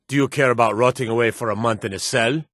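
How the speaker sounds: usual speaking voice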